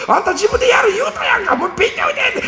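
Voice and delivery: high, shrill-voiced mother yelling; mock angry yell. A mother yells at her quitting son, hammed-up. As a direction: bark at the kid like a furious mom.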